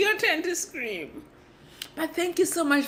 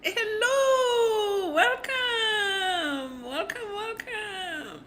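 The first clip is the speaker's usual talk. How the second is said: screamed greeting; 8 semitones higher, much slower than usual. Pretend. Streamer greeting the chat with her scream, high and strained. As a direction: scream the welcome then let it die down. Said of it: "Now my voice has gone hoarse".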